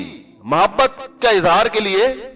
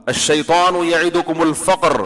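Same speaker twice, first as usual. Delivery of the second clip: Quran recitation; slower than usual. Acted.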